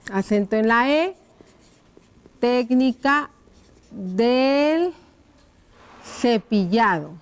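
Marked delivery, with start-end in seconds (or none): 2.4-7.1 one word at a time; slow dictation. Shown as a demonstration. Teacher writing out the brushing question, slow dictation. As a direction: leave room to write after each word.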